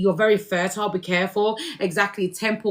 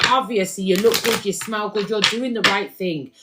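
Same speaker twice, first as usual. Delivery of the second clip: usual speaking voice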